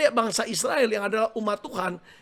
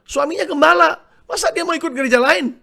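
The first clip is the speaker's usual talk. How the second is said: raised, higher-pitched incredulous protest; acted-out disbelief, pushed up in pitch; indignant objection; 7 semitones higher, 5 dB louder than usual. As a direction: object loudly as if it were absurd. Acts out indignant objection about wife.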